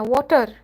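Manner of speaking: "water" ending in an exaggerated R roll; big rolled R. Shown as a demonstration. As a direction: roll the R hard at the end of "water". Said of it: "that was a big roll of the R in the end".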